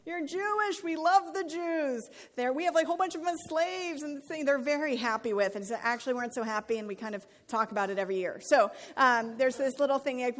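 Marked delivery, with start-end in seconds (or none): 0.0-5.6 higher, hammed-up Pharaoh gushing; movie Pharaoh impression. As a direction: put on a cheesy movie Pharaoh voice. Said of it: "And he's like".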